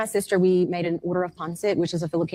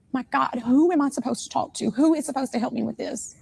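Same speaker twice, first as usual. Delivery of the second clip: exasperated plea; 7 semitones higher than usual. Retold or imitated. Mother passed between agencies, despairing.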